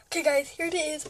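usual speaking voice

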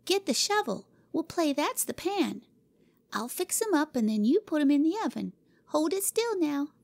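put-on high, firm child giving orders; the chief cook in charge; bossy little girl. Cora directing her assistant Jimmy: bossy high girl voice.

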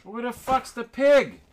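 high, loud angry yell; yelling across the room. Real, not acted. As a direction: call out across the shop, exasperated. Shouting for a missing tool.

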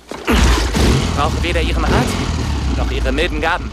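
loud, slow, bitter refusal; angry retort. Acted. Young man snapping at Bruce, loud and bitter. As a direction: spit the refusal back at him. Robin rejects Bruce angrily.

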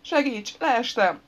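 high, loud whimper for help. A narrator's reading. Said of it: "nyöszörögte". (magas hangú, segélykérő nyöszörgés)

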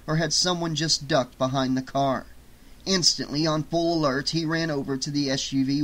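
ordinary delivery

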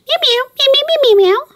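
very high little meows; kitten meowing. Acted. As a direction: squeak it like a tiny kitten. Cat answers the girl.

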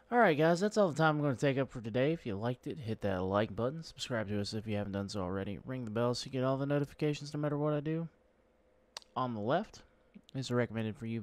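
loud, upbeat sign-off; YouTube outro. Acted. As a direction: hype up the outro.

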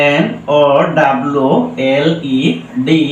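ordinary talk, nothing marked

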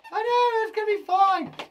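loud, squeaky-high comforting of a puppet; high, loud pet-talk; high-pitched reassurance. Pretend. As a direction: jump right up high to cheer the little one.

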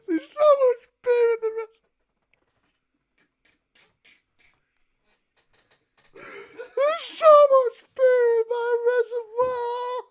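high, wailing, hammed-up sob; fake-weep it like a dramatic breakdown; mock crying. Prank caller fake-sobbing down the phone to the water company. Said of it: "I get choked up".

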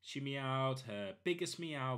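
usual speaking voice

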